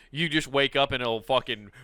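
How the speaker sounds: ordinary delivery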